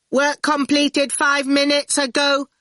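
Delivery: high, slow monotone robot; mouth-organ robot voice. A narrator's reading. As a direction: intone it like a talking mouth organ. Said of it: "it mouth-organed".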